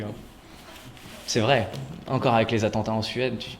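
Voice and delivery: ordinary talk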